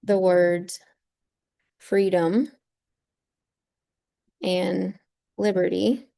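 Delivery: "freedom" and "liberty" set apart by long pauses; emphasis with pauses. Shown as a demonstration. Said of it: "is emphasizing".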